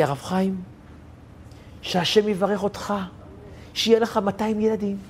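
soft mock-faint voice; weak blessing. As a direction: lightly imitate a drained woman giving blessings. Fainted neighbor recovers, blesses him.

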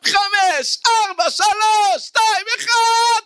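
high, drawn-out effort shouts; hammed-up high countdown yell; straining final reps. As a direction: scream out each count as you push. Straining through last gym repetitions.

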